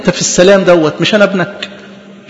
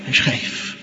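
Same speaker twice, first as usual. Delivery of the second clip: hushed whisper, barely breathed; 12 dB quieter, slower than usual. Acted. Preacher whispers Peter's thought on the stormy sea.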